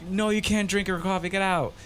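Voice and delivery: high mock bossy refusal; dismissive director. Director throwing the extra out, mock bossy. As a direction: shut him down like a bossy director.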